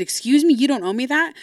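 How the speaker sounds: high, loud, incredulous question; retold affronted disbelief. Daughter answers dad saying he owes her nothing, affronted.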